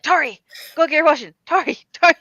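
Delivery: loud, high nagging call. Retold or imitated. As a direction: call her back to the washing. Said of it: "you can all be like".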